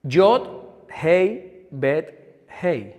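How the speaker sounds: "Yod, Hei, Bet, Hei" said letter by letter as Hebrew sounds; sounding out Hebrew. Shown as a demonstration. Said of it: "Si lo pronunciamos por sílabas". (pronunciando "Yod, Hei, Bet, Hei" sílaba por sílaba)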